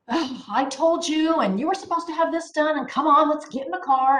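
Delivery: loud, high, hammed-up parental nagging; exasperated scolding. Parent rushing daughter to soccer with homework undone, exasperated. Acted scolding of an unready child.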